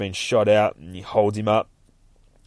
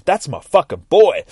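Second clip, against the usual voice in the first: ordinary delivery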